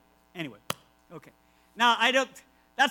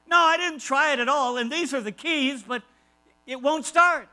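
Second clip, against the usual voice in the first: higher, louder protest that the car won't start; exasperated visitor; 5 semitones higher, 4 dB louder than usual. Retold or imitated. Visitor to preacher, insisting the car won't start, higher and louder.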